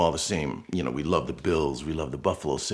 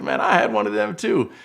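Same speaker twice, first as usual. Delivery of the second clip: put-on Southern accent; 7 dB louder than usual. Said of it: "Very Southern accent".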